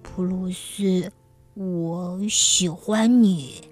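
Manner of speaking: tender. Acted. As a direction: say it gently and slowly. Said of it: "用温柔的声音说".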